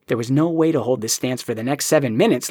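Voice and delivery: usual speaking voice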